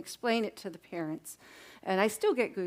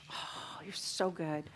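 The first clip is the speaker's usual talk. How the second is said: whispered praise; 5 semitones lower, 8 dB quieter, slower than usual. Real, not acted. Praising class quietly.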